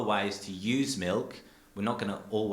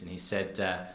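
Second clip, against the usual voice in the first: usual speaking voice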